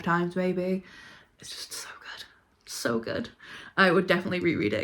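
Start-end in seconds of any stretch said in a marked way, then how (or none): 1.4-3.3 breathy whisper of pure love for the book; hushed swooning whisper; whispered gush. Real, not acted. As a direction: whisper it like a secret adoration. Gushing about favourite book.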